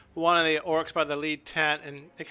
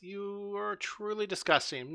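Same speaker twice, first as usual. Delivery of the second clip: slow, higher, softer character reply; acted disdain; 4 semitones higher, 6 dB quieter, slower than usual. Josette turning down the orc's harem offer, slow acted disdain. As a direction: draw out the disgust, quietly lofty. GM voices Josette refusing orc.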